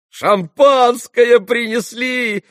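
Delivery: high, loud, drawn-out shout. A narrator's reading. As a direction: bellow it out mockingly, stretched slow. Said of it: "прокричал Ракитин".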